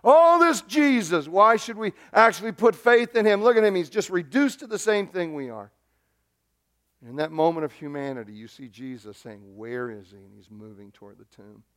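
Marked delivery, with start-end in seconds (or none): 0.0-5.7 high, agitated mocking crowd; acting out scornful bystanders; scoffing onlookers. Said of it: "even as others were saying". 8.3-11.6 low and very soft; quiet suspense; hushed storytelling. Retold or imitated. Hushed retelling at the tomb.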